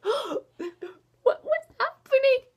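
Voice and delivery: high, tearful-sounding bewilderment; hammed-up near-sobbing shock; emotional disbelief. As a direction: gasp it out, overwhelmed and squeaky. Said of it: "that's when I started getting emotional".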